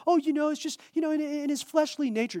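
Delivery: high, dismissive imitation of other believers; over-spiritualizing believer. Mimicking people who over-spiritualize the thorn, high mock voice. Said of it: "some people like to over-spiritualize this, go".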